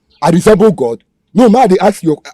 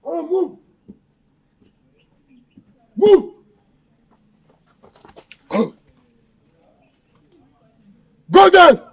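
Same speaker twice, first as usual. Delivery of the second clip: higher, harsh shouted commands; barked orders; 7 semitones higher, slower than usual. Acted. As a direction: bark orders at the captive. Kidnapper barking orders.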